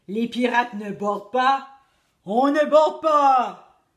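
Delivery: loud, slow indignant shout; crew shouting. A narrator's reading. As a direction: refuse it loudly as a crew. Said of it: "a crié l'équipage".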